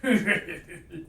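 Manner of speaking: giant's gloating belly laugh; big villain laugh; booming demon lord. Acted. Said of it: "this giant just belly laughing".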